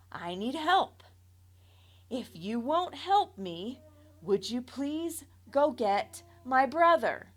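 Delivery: measured, pained explaining; calm but strained request; slow, careful plea. A narrator's reading. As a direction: slowly spell out the injury, pleading. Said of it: "I slowly informed".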